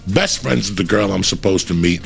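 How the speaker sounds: ordinary talk, nothing marked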